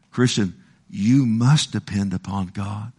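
ordinary delivery

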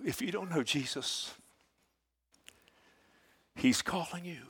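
usual speaking voice

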